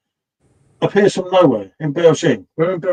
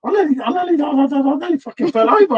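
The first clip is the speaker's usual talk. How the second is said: much higher, agitated disbelief; worked-up retelling; 9 semitones higher than usual. Retold or imitated. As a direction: still reeling as you tell it. Retelling shocking moment, worked up.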